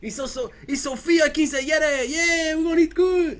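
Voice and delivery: high, excited mock Mexican. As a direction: caricature Mexican accent pushed into falsetto. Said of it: "that's as far as I go with Mexicans".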